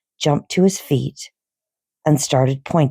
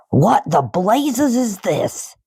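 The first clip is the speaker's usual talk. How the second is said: slow, higher bellow; angry yell; 6 semitones higher, slower than usual. Acted. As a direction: roar the question. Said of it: "yelled the Trunchbull".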